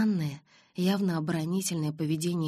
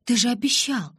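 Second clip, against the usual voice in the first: louder, higher protest; barely held-back indignation, raised voice; indignant outburst; 7 semitones higher, 7 dB louder than usual. A narrator's reading. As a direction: throw the broken promise back at him. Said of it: "Я едва сдерживала негодование".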